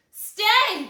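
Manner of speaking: high, loud monster order; commanding shout. Acted. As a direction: bellow the command. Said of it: "the creature commanded".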